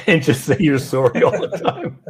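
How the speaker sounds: words spilling out through a real laugh; chuckling while teasing the co-host. Real, not acted. Teasing the co-host about Canadian politeness, laughing.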